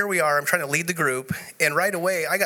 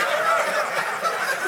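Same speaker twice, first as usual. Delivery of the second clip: breathless laughter. Real, not acted. Still laughing at the joke.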